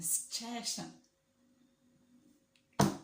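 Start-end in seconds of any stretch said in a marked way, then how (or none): 0.0-0.9 "situation" run together as "s'chay-shun"; "situation" said his way, with an exaggerated "chay"; mimicked pronunciation. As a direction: drop the copied "situation" into your own sentence, long on "ay". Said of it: "it's the way he says situation".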